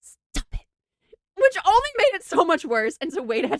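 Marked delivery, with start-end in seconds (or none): 0.0-0.6 hushed whispered warning; urgent whispered stop it. Retold or imitated. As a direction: whisper it so nobody else hears. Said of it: "he's like".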